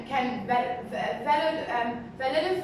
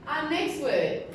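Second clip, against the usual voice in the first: usual speaking voice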